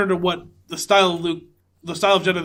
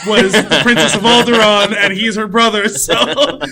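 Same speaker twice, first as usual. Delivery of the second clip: slow, laughing through the words; can't keep a straight face; laughing while talking; 3 semitones higher, 6 dB louder, slower than usual. Real, not acted. Explaining Leia's royal brother to a co-host, laughing.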